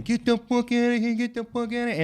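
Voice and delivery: high, loud garbled holler; mouthful-of-peanut-butter yell; deaf mother-in-law. Retold or imitated. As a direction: imitate her muffled deaf shouting. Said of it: "and she goes again".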